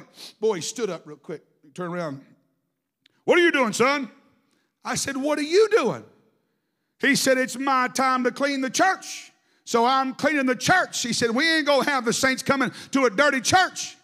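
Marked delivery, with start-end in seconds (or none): 3.2-4.1 louder, higher startled question; father startled at the church, sharp loud question. Retold or imitated. Startled father confronts son, acted. 5.2-6.0 retold retort. Son answers father, acted quarrel. 7.4-14.0 put-on stubborn old dad insisting on cleaning the church; stubborn insistence. Stubborn father insists, acted.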